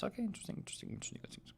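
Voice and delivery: very soft, breathy whisper; whispered to himself. Real, not acted. Streamer reading notifications, whispering to himself. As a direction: whisper it as if thinking aloud.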